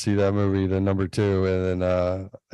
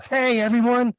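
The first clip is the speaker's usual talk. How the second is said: put-on kid yelling; 12 semitones higher than usual. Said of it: "Hunter got up on the table and yelled".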